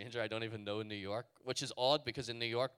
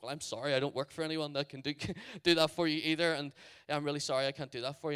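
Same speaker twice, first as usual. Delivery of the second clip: higher, flustered, hurried apology; mild panic; 4 semitones higher, 6 dB louder, faster than usual. Retold or imitated. Refusing a record deal to a stranger, mildly panicked. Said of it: "I start to panic".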